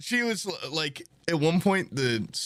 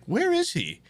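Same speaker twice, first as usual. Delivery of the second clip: lightly acted, higher-pitched puzzled questioning; puzzled dinner guests; 8 semitones higher than usual. Friend voices dinner guests wondering where he is, mildly acted puzzlement. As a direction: call out higher, as mock-concerned guests. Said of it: "everyone downstairs is like".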